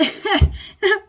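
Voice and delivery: a sudden loud laugh; loud burst of laughter. Real, not acted. Laughs at her own story.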